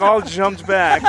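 ordinary delivery

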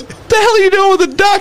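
high, incredulous outburst; mock disbelief. Dealer questions crackhead about the duck, incredulous.

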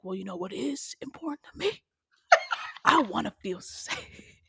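serious character voice. Acted. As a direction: drop into a hammed-up serious character, near whisper. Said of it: "That's my serious voice".

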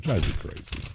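breathy exasperated whisper; whispered exasperation. Real, not acted. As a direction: hiss it in frustration. Exasperated muttered aside.

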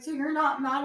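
put-on high little-kid question; imitated hopeful daughter. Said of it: "she's like".

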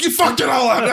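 high, re-enacted angry yell. Marine berates midshipman for botched buttoning, yelling. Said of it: "I'm, like, yelling at this kid".

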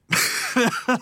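high-pitched laugh. Real, not acted. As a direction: burst out laughing mid-story. Laughs while retelling the mix-up.

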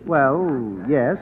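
sheepish. Acted. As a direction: mumble it guiltily. Sheepish admission to girlfriend.

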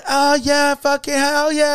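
high, slow whooping; hammed-up high party whoop; mock party cheer. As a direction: imitate the ladies cheering as they dance. Imitating dancing ladies cheering.

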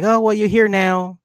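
high-pitched placating dad; evasive reassuring dad. Retold or imitated. Dad dodges his revived daughter's memories, high-pitched reassurance.

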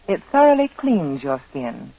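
ordinary delivery

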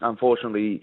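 ordinary talk, nothing marked

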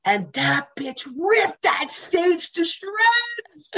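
slow, high, loud rave with a cry; raving. Real, not acted. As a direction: gush in awe at the performance. Raving about a performance.

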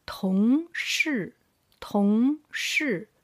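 同事 with 同 rising, 事 falling; second-plus-fourth tones. Shown as a demonstration. Teacher drills second with fourth tone, 同事 rising then falling. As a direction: stretch 同 upward, then drop 事. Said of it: "second tone with the fourth tone".

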